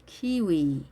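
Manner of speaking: KIWI with both vowels drawn out, "키위"; long vowels. Shown as a demonstration. Teacher modelling fruit name for learners, long vowels in "키위". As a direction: say "키위" slowly, both syllables long. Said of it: "둘 다 길게".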